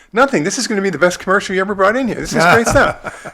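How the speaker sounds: excited praise. Retold or imitated. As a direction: burst out with delight at the footage. Editor praises the film enthusiastically.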